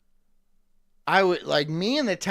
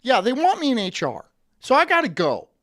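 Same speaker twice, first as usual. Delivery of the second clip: higher and louder, brisk; lightly voiced office worker on the phone; mock phone answer; 6 semitones higher, 8 dB louder than usual. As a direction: pretend to take a call. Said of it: "I'm getting a call".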